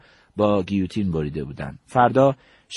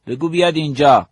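higher, louder firm command; insistent order; 6 semitones higher, 7 dB louder than usual. Retold or imitated. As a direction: overrule the objection with a raised-voice order. Commander cuts off aide's objection. (دستور قاطع با صدای بلندتر)

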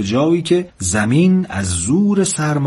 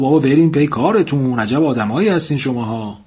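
ordinary delivery, nothing marked

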